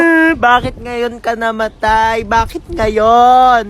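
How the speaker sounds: very slow wailing grief; slow, overdone funeral wail; drawn-out mock lament. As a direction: stretch every word like a hammed-up mourner. Mourning at a coffin, drawn out.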